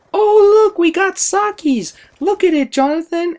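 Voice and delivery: put-on high, loud old-lady voice, delighted; grandmother's voice. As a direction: squeal it like an excited grandma. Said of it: "my grandmother looks at me and goes".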